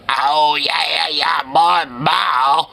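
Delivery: silly put-on voice, mimicking a singer; weird little singer's voice. Host mimicking the singer's song voice, weird little voice. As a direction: imitate a goofy little song voice. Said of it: "Like, that little voice".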